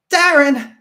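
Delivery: frantic scream. A narrator's reading. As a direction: scream his name. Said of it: "she screamed".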